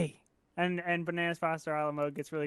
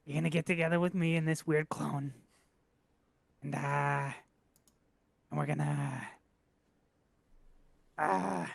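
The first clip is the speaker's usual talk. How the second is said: low, halting delivery with long pauses; lightly acted last words, trailing and subdued; dying messenger; 3 semitones lower than usual. Player's character breaking bad news while dying, low halting voice.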